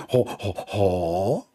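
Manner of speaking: low, slow donkey grunts. Acted. Donkey answers the angel, low slow grunting. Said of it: "The donkey, he said".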